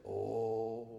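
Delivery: low, soft, drawn-out "Oh"; a deep, moved "Oh". Retold or imitated. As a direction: sigh it out like the old man Melvin did. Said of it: "That's how he talked".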